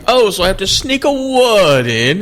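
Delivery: high, slow, drawn-out mocking voice; hammed-up celebrity parody; Russell Brand. Annoying guest explaining the word game, mock impression. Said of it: "do a Russell Brand impression".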